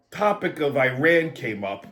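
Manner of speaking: normal talking voice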